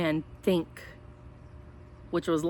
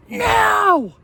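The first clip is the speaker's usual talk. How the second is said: high, loud battle shout; dinosaur yell; 13 semitones higher, 8 dB louder than usual. A narrator's reading. Dinosaurs charging the house, loud high yell. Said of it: "trio of dinosaurs yelling".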